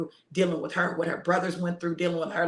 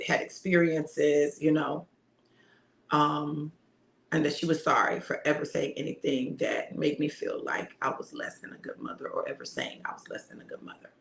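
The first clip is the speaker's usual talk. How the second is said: ordinary delivery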